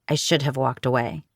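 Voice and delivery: usual speaking voice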